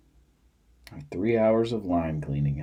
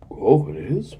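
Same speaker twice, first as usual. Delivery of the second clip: put-on high surprised old man; imitating innocent surprise; mock surprise; 7 semitones higher, 6 dB louder than usual. Father's surprised reply.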